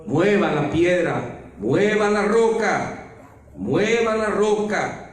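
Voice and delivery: commanding. Acted. Jesus commands moving the stone.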